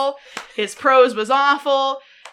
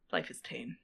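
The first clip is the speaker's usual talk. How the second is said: low, soft, doom-laden sigh; gloomy affected drama; 12 semitones lower, 16 dB quieter than usual. Acted. Friend playing affected gloom, low sighing drone. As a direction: play the world-weary tragic soul. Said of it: "I have a very affected demeanor".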